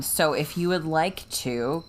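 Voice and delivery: ordinary delivery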